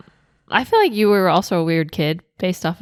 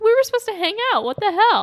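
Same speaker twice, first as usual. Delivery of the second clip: high, quick put-out whine; mock whiny complaint; 13 semitones higher than usual. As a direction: whine like a friend left behind. Mocking the left-out friend.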